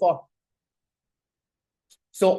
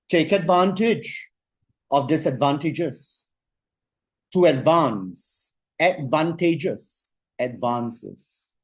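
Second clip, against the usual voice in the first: slow, deliberate dictation pace; very slowly; 2 semitones lower, much slower than usual. Shown as a demonstration. Coach dictating a motto for the class to write, very slow. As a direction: lay each word down slowly so they can write it. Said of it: "I'm going to say it very slowly".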